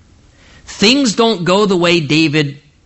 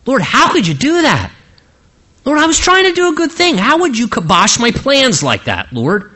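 angry protest; 9 semitones higher than usual. Retold or imitated. David shouting up at God over his ruined plans, angry. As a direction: raise your voice in hurt outrage at the Lord. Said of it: "he gets angry".

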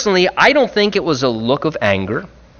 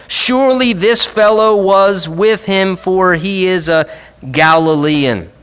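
confident accusation; 6 semitones higher, 4 dB louder than usual. Retold or imitated. Bystander confidently accusing Peter.

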